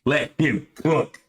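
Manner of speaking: slow put-on delivery; drawn-out catchphrase. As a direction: stretch each word out for effect. Repeats the slang phrase, drawn out.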